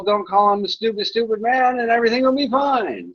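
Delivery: high, fast singsong imitation; high mock troll voice. Streamer imitating the troll's message, high and fast. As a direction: rattle it off in a high silly voice. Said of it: "Or as well".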